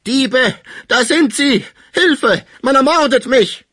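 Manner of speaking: high, slow shriek for help; piercing scream. A narrator's reading. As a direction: scream in panic at thieves. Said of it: "schrie durchdringend auf".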